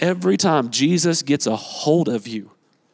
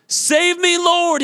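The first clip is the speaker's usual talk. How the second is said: higher and louder cry for help; louder shout; 11 semitones higher, much slower than usual. Retold or imitated. Preacher repeating Peter's plea, louder and higher in pitch. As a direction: really cry out, desperate. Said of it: "he shouted".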